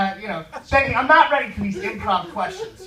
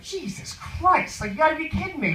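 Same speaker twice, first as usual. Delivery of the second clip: mock disbelief at a pest; retold exasperation; 8 semitones higher than usual. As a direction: act out being fed up, pitched up. Retold exasperation at chatty passenger.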